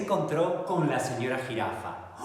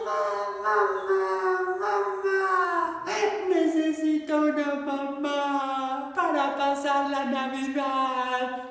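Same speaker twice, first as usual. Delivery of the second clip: crying duckling; 10 semitones higher, 4 dB louder, much slower than usual. Acted. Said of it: "se puso a llorar".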